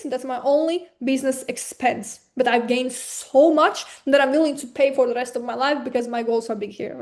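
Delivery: normal talking voice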